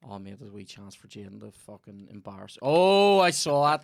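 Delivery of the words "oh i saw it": sudden exclamation. Real, not acted. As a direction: burst out loud with sudden recognition. Sudden loud recollection.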